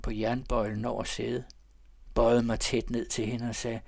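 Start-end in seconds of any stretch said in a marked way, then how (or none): none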